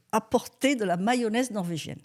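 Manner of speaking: normal talking voice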